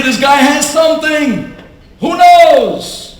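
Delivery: high, loud burst of hope; excited hope. Retold or imitated. Husband to his wife in the doctor's office, excited. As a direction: blurt it out, suddenly hopeful.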